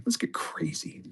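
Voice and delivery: breathy secret whisper. Pretend. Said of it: "repeated as whisper".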